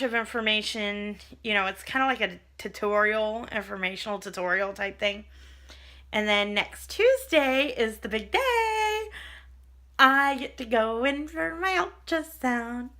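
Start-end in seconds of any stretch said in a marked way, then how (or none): 6.1-12.9 giddy, drawn-out build-up; slow, higher-pitched thrilled announcement; real excitement. Real, not acted. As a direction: stretch the words out with rising excitement. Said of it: "I'm so excited".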